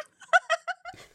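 high, loud laugh; helpless high-pitched laughing; burst of laughter. Real, not acted. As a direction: laugh out at your own story. Laughs at her own story.

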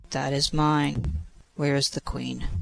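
ordinary delivery, nothing marked

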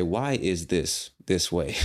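"Why is this this way?" asked slowly; slow, deliberate model question. Shown as a demonstration. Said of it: "you should say".

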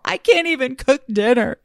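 a touch of a whiny wail, then a high sigh; mock despair. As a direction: play your own overwhelmed inner voice, half-joking. Acts out overwhelmed inner voice.

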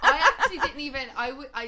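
loud words through laughter; laughing. Real, not acted. Host laughing along with Roberta.

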